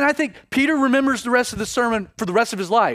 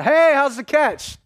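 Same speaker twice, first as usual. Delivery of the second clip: high, louder, drawn-out call; yelling out; 7 semitones higher, 5 dB louder, slower than usual. Acted. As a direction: call out to fishermen from the shore. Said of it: "someone yells out".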